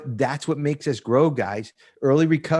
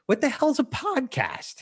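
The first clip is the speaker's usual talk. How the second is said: much higher, slow, bewildered; baffled question; 14 semitones higher, slower than usual. Retold or imitated. To his friend, asking about podcasts in a high baffled drawl. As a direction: play dumb about podcasts, slowly. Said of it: "I'm like".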